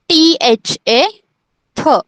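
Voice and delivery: थ said alone after its letters "टीएचए"; pronunciation demo. Shown as a demonstration. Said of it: "थ को कैसे pronounce करना है".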